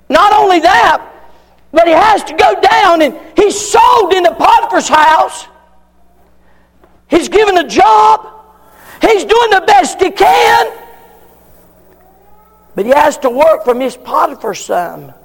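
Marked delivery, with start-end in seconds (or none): 0.1-10.7 higher, louder, shouted sermon build; slowing into a shouted peak; preaching at full force. Acted. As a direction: climb to the climax, each phrase hammered.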